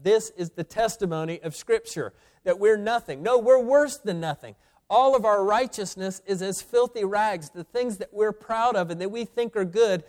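high, insistent pulpit delivery; forceful preaching. Real, not acted.

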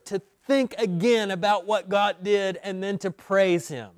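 building preacher's cadence; rising sermon fervor. Acted. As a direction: lift the congregation toward praise.

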